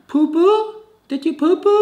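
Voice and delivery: high, questioning nurse with broken English; mimicking the nurse. Nurse asking the patient about his bowels, in a high, questioning voice. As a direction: ask it like the nurse who barely speaks English. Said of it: "Das war so das Einzige, was sie mich fragen konnten".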